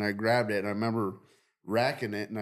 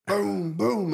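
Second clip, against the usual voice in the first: gunshot sounds; 8 semitones higher, 3 dB louder than usual. Retold or imitated. Imitating gunshots in story.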